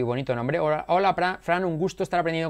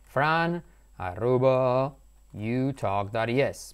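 drawn-out, lower dictation with gaps; slow dictation; 3 semitones lower, much slower than usual. Real, not acted. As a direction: spell out the address very slowly, piece by piece. Dictating an email address.

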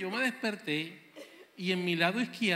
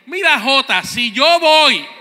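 mom's threat; 4 semitones higher, 16 dB louder, slower than usual. Retold or imitated. Madre amenaza.

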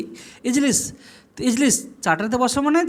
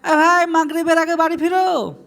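calling to a friend; 8 semitones higher, 4 dB louder than usual. Shown as a demonstration. Telling a friend to return before Maghrib, calling out. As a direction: holler to a mate to get home. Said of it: "আপনি আপনার বন্ধুকে বলতে পারেন".

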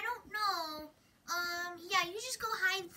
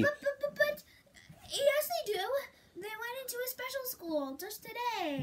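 flustered protest; 7 semitones higher than usual. Acted. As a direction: scramble to defend the lie. Desperately insisting on the lie.

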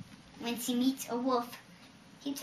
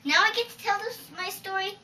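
high, louder, fussing little bird; higher indignant hen, building louder; high squawky complaint; 7 semitones higher, 8 dB louder than usual. Acted. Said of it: "said Little Wren".

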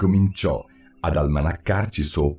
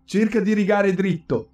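much higher, scolding mouse; cross mouse, pitched way up; 13 semitones higher than usual. A narrator's reading. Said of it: "disse il topo".

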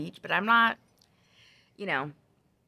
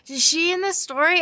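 put-on higher, louder curious child voice; son asking; 11 semitones higher, 7 dB louder than usual. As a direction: ask like a puzzled little boy. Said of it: "And he's like".

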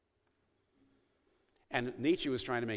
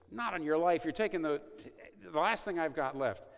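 higher, louder flat refusal; a proud gangster refusing to give up his last pride; raised, defiant no; 6 semitones higher, 4 dB louder than usual. Retold or imitated.

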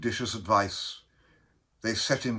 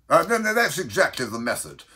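fast, loud, higher interjection; hasty cry; 6 semitones higher, 5 dB louder, faster than usual. Acted. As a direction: blurt it out in a rush. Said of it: "in a hasty parenthesis, cried".

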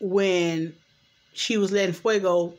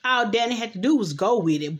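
ordinary talk, nothing marked

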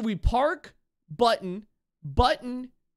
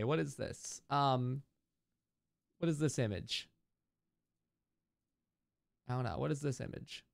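soft, under the breath, confused; quiet puzzled muttering; 8 semitones lower, 8 dB quieter than usual. Real, not acted. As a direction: mutter it low to yourself, baffled. Puzzled muttering at strange image.

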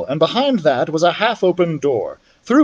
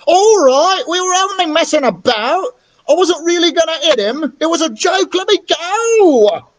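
put-on pleading boy; 13 semitones higher, 3 dB louder than usual. Boy begs with ear pulled.

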